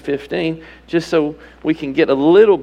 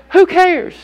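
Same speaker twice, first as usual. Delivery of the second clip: higher, louder, exasperated; exasperated outburst; 10 semitones higher, 5 dB louder than usual. Real, not acted. Preacher cutting off denominational excuse, loud and higher. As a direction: throw it off with a loud retort.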